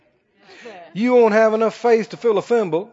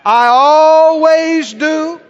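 very slow, stretched-out proclamation; out-loud declaration; 5 dB louder, much slower than usual. Shown as a demonstration. Preacher leading the congregation to say it, drawn-out declaration. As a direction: hold "always" long and strong. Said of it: "Say it again".

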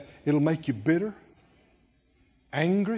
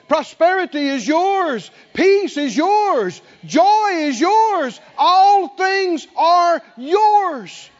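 from strong to higher and louder, slow and emphatic; building preaching; 7 semitones higher, 6 dB louder, slower than usual. Acted. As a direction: preach each promise harder, climbing to a shout. Preaching builds to full force.

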